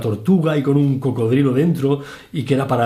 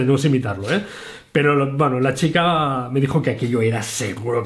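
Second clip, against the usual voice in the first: normal talking voice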